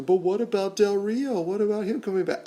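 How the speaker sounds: high-pitched mock fan protest; whiny-pitched imitation of objectors; complaining fans. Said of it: "a lot of people are saying".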